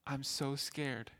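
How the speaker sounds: soft and slow, voice sinking; quiet scared words. Retold or imitated. Confused old woman admitting her fear to the helper, softly and slowly. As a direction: let it fall softly, slow and small.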